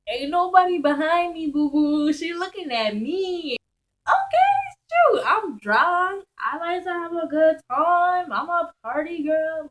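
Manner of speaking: high, loud, tipsy glee; excited party girl. Retold or imitated. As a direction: hype yourself up, loud and giddy. Drunk inner voice, hyped up.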